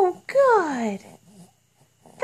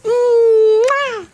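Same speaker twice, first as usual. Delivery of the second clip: big smacking kiss; loud kiss smack; 3 semitones higher, 5 dB louder than usual. Real, not acted. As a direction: blow a loud noisy kiss. Mother kissing her baby.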